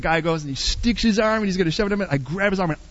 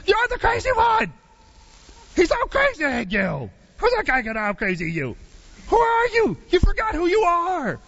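high, fired-up yell at a player; a coach roaring to wake you up; 8 semitones higher than usual. Retold or imitated. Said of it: "And he goes".

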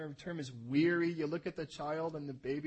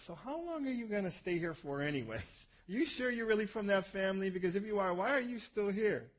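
exasperated host; 5 semitones higher than usual. Acted. Uncle to nephew staying beyond his welcome, mock exasperated. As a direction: play a host pointedly challenging a guest. Host confronting overstaying guest, acted.

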